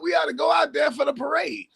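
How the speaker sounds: laughing as he teases his co-host about the parade; half-shouting through a laugh. Real, not acted. Teasing co-host after the loss.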